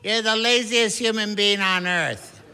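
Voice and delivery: lightly voiced exasperated father; dad scolding. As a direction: scold the lazy son. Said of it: "As my dad once said".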